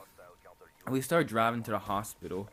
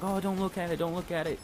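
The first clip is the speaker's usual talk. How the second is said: higher-pitched alarm at normal volume; mock screaming onlookers; 7 semitones higher than usual. Bystanders warn skater off his broken ankle, mock alarm. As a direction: imitate horrified bystanders without really yelling. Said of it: "screaming and shit".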